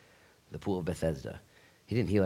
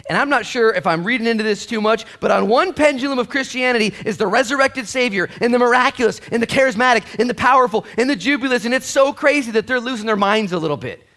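higher and louder, preaching hard; full-force preaching; 13 semitones higher, 11 dB louder than usual. Real, not acted. Pastor rousing the church, full-force preaching. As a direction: lift the room with it.

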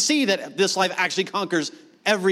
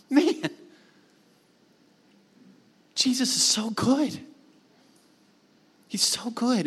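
awed praise; 4 semitones higher, 3 dB quieter, slower than usual. Real, not acted.

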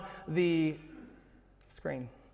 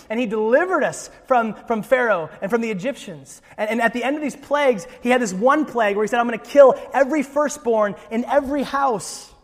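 put-on higher, animated storyteller father without accent; 6 semitones higher, 5 dB louder than usual. Father retelling Exodus to his family at the Passover table, higher and animated. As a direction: tell it like the head of the house at Passover, caught up in it.

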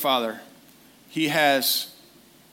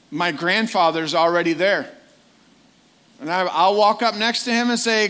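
normal talking voice

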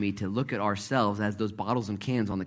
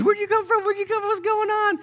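much higher, frantic mock panic; panicked disciples; 6 dB louder than usual. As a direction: squeal it like startled men hiding. Disciples freaking out, voiced.

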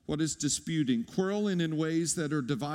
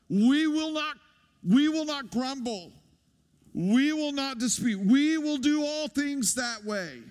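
louder, higher preaching push on each line; rallying declaration; 7 semitones higher, 4 dB louder than usual. Real, not acted. Pastor calls the congregation to a resolve, raised and forceful. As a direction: proclaim it like a vow for the whole church.

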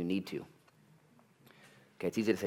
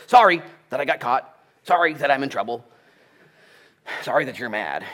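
mock fake apology; 5 semitones higher, 10 dB louder than usual. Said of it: "repentance isn't just".

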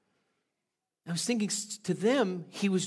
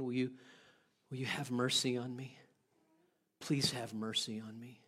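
soft, lower begging; hushed plea for forgiveness; quiet pleading; 5 semitones lower, 9 dB quieter than usual. Pretend. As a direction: plead quietly with someone you love. Begging a loved one for mercy.

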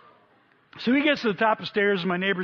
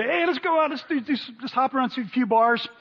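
high, eager party-guy voice, much higher; mimicking rowdy buddies; 6 semitones higher than usual. High school buddies urging Evan out, high and eager. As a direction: play the buddies egging him on. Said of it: "they say".

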